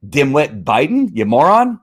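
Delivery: ordinary delivery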